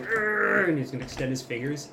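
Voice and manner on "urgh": high, loud effort noise; strained growl of magical effort; straining grunt. Acted. Vod summoning power over the ocean, loud straining grunt. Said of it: "And Vod's going".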